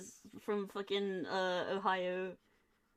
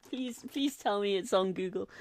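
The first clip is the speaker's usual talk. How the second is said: normal talking voice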